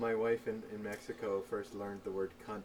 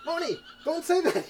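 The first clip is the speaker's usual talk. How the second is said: much higher, louder pleading rebuke; exasperated scolding; 9 dB louder than usual. Retold or imitated. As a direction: scold her, pitched way up. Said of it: "I'm like".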